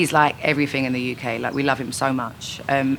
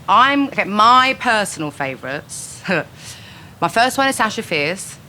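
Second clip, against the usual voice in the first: louder, higher, slower build-up; bold announcement; 6 semitones higher, 4 dB louder than usual. Real, not acted. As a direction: announce it like a big reveal. Bracing for controversial picks.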